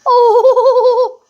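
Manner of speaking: amused laugh. Real, not acted. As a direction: laugh out loud at it. Laughing at cartoon.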